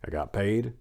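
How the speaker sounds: usual speaking voice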